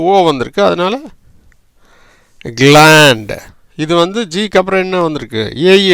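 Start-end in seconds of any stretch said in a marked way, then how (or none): 2.6-3.3 கிளாண்ட் said loud and clear as glaand, ending on a hard ND; teacher sounding out கிளாண்ட் for the class; model pronunciation. Shown as a demonstration. Teacher pronounces கிளாண்ட் slowly for students. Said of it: "அதனால எப்படி கிளாண்ட்".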